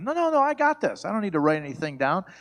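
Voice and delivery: high, cocky brush-off, fast at the end; dismissive husband. Retold or imitated. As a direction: wave off his wife's worry. Husband brushing off wife's request.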